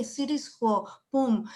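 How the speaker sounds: ordinary delivery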